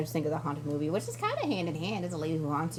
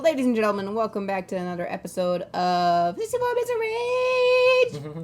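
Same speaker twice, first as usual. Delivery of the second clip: announcer voice; 6 semitones higher, 6 dB louder than usual. Pretend. Mock podcast intro.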